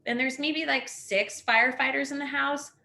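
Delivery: ordinary talk, nothing marked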